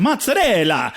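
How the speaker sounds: mozzarella with showy Italian vowels and tapped r; mock Italian flourish on mozzarella; over-Italian pronunciation. Comic mimics Irish wife showing off, over-Italian mozzarella. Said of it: "she just said it like, like that".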